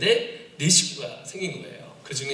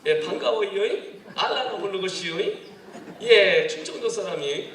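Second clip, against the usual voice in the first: high, loud Chungcheong lilt; Chungcheong accent; 6 semitones higher, 4 dB louder than usual. Shown as a demonstration. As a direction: ham up a Chungcheong country dialect. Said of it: "저는 사실 충청도 사람이에요".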